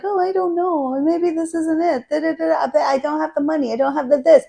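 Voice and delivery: a hint of a hesitant inner voice; doubting inner voice. She voices the viewer's doubting mind, mildly whiny. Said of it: "your mind will tell you things like".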